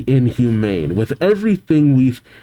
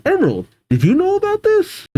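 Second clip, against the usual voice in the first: high-pitched; faster than usual. Acted. Sapphire asks in high voice.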